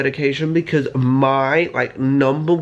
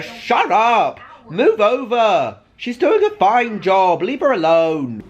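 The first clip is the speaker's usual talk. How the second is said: mock indignant; 5 semitones higher, slower than usual. Defending mayor candidate.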